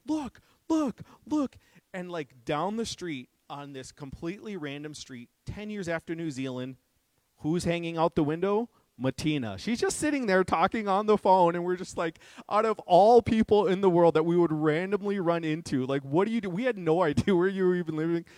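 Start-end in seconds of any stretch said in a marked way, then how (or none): 0.1-1.6 much higher, louder voice urging him to look; a friend bursting to show something; excited pointing. Retold or imitated. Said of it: "And he's like". 9.6-18.2 much louder, higher telling at full pitch; reliving the disbelief of the meeting; amazed retelling. Real, not acted.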